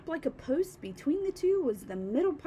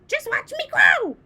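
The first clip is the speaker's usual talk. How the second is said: high, loud boastful call; gleeful crone shout; 12 semitones higher, 11 dB louder than usual. A narrator's reading. Grandma boasting to George, loud shout. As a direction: shout it out proudly. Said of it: "she called down".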